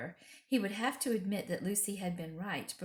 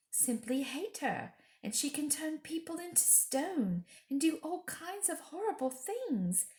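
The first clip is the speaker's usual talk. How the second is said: put-on higher, earnest girl telling a story; higher, earnest little girl; young girl's voice; 6 semitones higher than usual. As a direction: tell it wide-eyed and eager. Lucy speaks.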